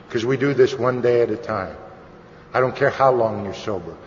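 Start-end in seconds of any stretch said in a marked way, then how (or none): none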